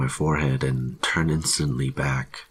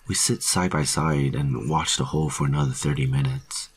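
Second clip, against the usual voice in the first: plain reading voice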